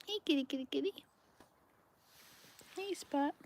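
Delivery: soft, high coaxing call; soft high coaxing to a stray cat; soft kitty call. Real, not acted. Coaxing stray cats closer.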